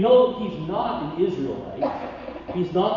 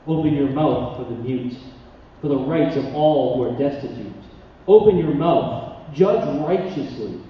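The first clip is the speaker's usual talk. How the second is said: scripture reading; 5 dB louder than usual. Acted. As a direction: proclaim the passage with weight. Reads Proverbs 31 passage aloud.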